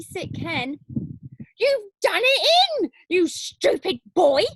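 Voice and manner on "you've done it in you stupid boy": loud, high scolding yell; old woman yelling in anger; angry shout. Acted. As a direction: shout at the boy, furious. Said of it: "Grandma shouted".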